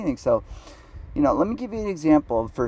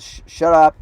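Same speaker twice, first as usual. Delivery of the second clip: loud, higher-pitched imitated shush; retold rebuke; 4 semitones higher, 10 dB louder than usual. Date silencing him while she eats, retold loud rebuke. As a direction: act out an irritated date cutting in.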